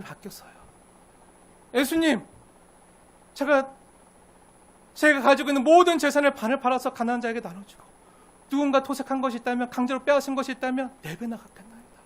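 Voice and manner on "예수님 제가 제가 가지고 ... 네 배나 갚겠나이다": louder, higher earnest pledge; fervent vow. Acted. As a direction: promise everything with passion. Zacchaeus vows to repay.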